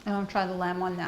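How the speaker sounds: talking with her mouth full; speaking through a mouthful of pie. Real, not acted. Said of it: "I'm talking with my mouth full".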